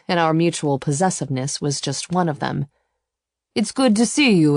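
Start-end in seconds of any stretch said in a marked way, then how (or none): 3.5-4.6 a hint of an Italian accent, higher; light Italian accent. A narrator's reading. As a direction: greet her with a touch of Italian accent. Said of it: "with his lovely Italian accent".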